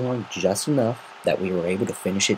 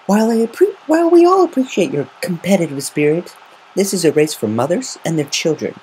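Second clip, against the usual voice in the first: high, louder, stately mare; politely but firmly refuse; mayor's voice; 9 semitones higher, 5 dB louder than usual. Acted. Mayor Mare telling Rainbow Dash the race is for mothers, high and firm.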